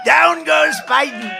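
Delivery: booming ringside shout of a knockdown; shouted knockout call. Shown as a demonstration. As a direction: roar it like the fight just ended. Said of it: "I go like this".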